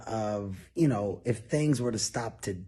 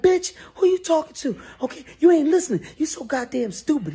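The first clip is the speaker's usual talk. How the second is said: much higher and louder, fast screeching; a wife screaming at him; shrieking wife; 14 semitones higher, 6 dB louder, faster than usual. Retold or imitated. Wife yelling at boss.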